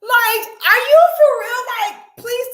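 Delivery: high loud shriek of disbelief; squealing are you for real. Real, not acted. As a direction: scream it at her, unable to believe it.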